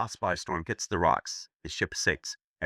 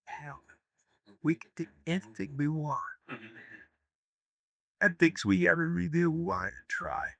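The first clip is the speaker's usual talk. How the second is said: sultry woman; 8 semitones higher than usual. Acted. Seductive woman's pitch in joke.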